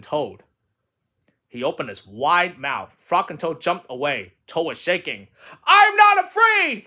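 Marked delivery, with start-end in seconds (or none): 5.6-6.8 high, loud, drawn-out cry; frightened cry. Acted. Shaking Toad denies his fear after the snake, high loud cry. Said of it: "he cried".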